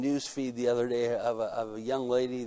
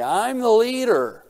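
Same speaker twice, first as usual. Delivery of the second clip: loud, slow proclamation, chest out; mock proud declaration; 7 semitones higher, 9 dB louder, slower than usual. A husband telling his wife he's the leader, loud and slow. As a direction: play the man announcing he's in charge. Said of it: "look at our wives and say".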